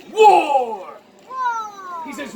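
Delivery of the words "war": loud "War!" falling away, drawn out; war cry. Acted. She insists to him on battle, loud proclamation. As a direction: proclaim it firmly in answer. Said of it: "She says".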